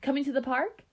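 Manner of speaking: put-on high girlish question, lifting at the end; Michelle. A narrator's reading. Friend in ghost costume invites Keisha, high and casual. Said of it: "in her friend Michelle's voice".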